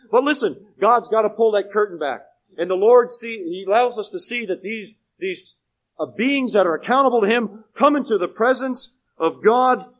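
loud sermon delivery with punched key words; emphatic preaching. Real, not acted. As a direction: drive the point home to the congregation. Preaches at full force.

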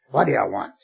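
mock gruff growl through the door; a playful rough daddy voice. Father teasing his son through the door, mock gruff. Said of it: "in a rough, gruff voice".

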